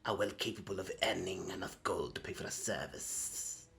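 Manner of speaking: soft, unvoiced whisper; quiet condescending whisper; whispered retort. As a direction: breathe it out dismissively. Kobold lowers voice.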